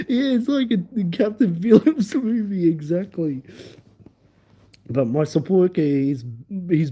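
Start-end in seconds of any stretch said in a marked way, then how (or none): 0.1-3.4 high-pitched, laughing through the words. Real, not acted. Amused storyteller explaining the pirate joke, laughing. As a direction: push it out through giggles. Cracking up over own story.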